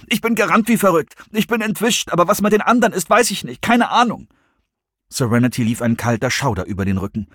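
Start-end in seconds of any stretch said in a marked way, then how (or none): 0.1-4.3 high, agitated, breaking-point report; frantic and high-pitched; all coolness gone. A narrator's reading. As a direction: blurt it out in distress. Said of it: "Nichts mehr übrig von seiner Coolness".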